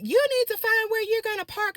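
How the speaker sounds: high, snippy mock driver; imitating the other driver. The other driver scolding her, high and snippy. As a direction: mimic her bossy tone up high.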